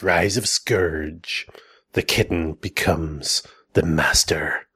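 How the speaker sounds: low, slow trailer voice; dramatic title announcement. Acted. Dramatic readout of comic titles.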